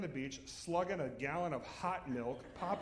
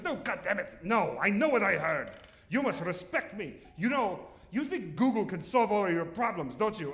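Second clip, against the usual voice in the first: mock angry; 4 semitones higher, 6 dB louder than usual. Mother-in-law yelling in car.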